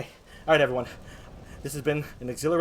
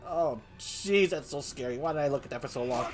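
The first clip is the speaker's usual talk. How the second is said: normal talking voice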